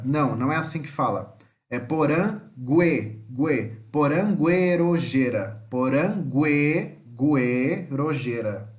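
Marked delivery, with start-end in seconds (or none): 1.7-5.6 poranguerojeira with gue said gwe; syllable by syllable: porangue- gue, poranguerojeira; gue like güe. Shown as a demonstration. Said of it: "Como se tivesse uma trema". 5.7-8.7 Porangue- guerojeira split slowly, gue said gwe; slow split with gwe sound; gue like güe. Shown as a demonstration. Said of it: "Como se tivesse uma trema".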